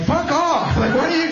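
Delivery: high-pitched, exasperated comeback; indignant and incredulous, voice jumping high; outraged retort. Retold or imitated. Outraged retort to child's advice.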